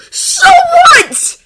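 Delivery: very high, loud retort; furious shout. A narrator's reading. Draco dismissing Harry's reasons, furious screaming. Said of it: "Draco shouted".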